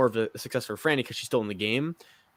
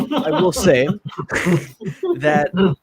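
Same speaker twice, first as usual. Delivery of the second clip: louder, higher, broken up by laughter; laughing; 4 semitones higher, 7 dB louder, slower than usual. Real, not acted. Co-host ribbing a friend's long monologue, laughing. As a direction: talk through a real laugh.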